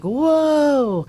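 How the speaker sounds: put-on high, loud kid's whoa; a wide-eyed kid gasping whoa; amazed whoa. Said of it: "the kids are like".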